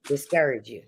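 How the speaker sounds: usual speaking voice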